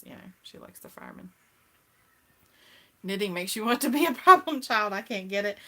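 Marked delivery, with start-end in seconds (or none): 0.0-1.3 whispered aside, much softer; sly whisper. Real, not acted. Confiding to viewers why mama pulls alarms, whispered. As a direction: drop to a conspiratorial whisper.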